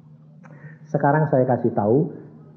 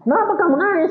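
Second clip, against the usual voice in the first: high, incredulous question; pitched-up retold surprise; acted disbelief. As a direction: question the weeper in mock astonishment. Acted disbelief at the crying man.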